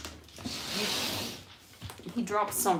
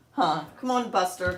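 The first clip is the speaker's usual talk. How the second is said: usual speaking voice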